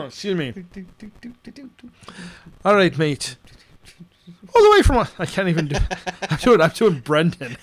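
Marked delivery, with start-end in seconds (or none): 2.6-5.1 badly faked Australian, leaping high and loud; attempted Australian accent. Host introduces an Australian cacher, shaky attempt at Australian accent. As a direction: have a go at Australian, pitch jumping up. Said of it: "can't even do an Australian accent anymore".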